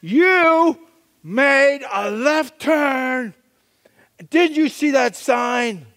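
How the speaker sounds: loud, stern traffic-cop scolding; stern officer. Retold or imitated. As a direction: bark the charge at the driver. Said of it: "she said".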